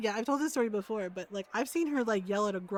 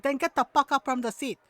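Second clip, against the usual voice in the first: louder imitation of mom's accented order; scolding mom; 2 semitones higher, 5 dB louder than usual. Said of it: "like, the mom tone and everything".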